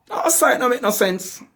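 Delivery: high, fast mocking retort; exasperated patois. Preacher mocking the excuse-maker, high and fast. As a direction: throw up your hands in patois.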